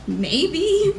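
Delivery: squeaky unsure maybe; a nervous high-pitched maybe; high awkward maybe. Retold or imitated. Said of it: "I was like".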